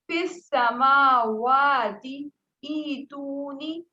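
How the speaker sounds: slow recited فی السماواتی ایتونی, vowels drawn out; Quranic recitation. Shown as a demonstration. Teacher recites the verse for the student, slow and melodic. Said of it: "جیسے کیسے؟".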